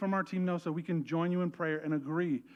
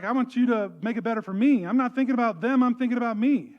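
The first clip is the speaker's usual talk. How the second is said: louder, higher, self-absorbed whine; mock selfish demand; 6 semitones higher, 9 dB louder than usual. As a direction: parody a selfish prayer. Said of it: "You're like".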